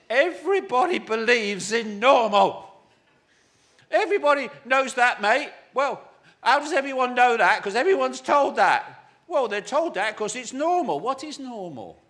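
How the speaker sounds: loud, high-pitched incredulous tirade; worked-up rant. Real, not acted. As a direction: hammer it out, mocking the common belief. Mocks what everybody believes.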